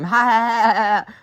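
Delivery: high, loud "ha-ha" laugh; mocking laugh. Real, not acted. Laughs at own accident story.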